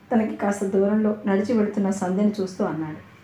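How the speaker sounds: plain reading voice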